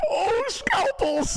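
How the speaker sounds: high, slow squealing cry; falsetto excited shout. Pretend.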